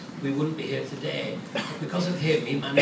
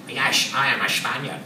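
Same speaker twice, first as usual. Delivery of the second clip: put-on low Scottish accent with slushy S sounds; mock a Scottish star playing Spanish in an unchanged accent; slurred-S Scottish impression, pitched down; Sean Connery; 5 semitones lower than usual. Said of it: "Sean Connery".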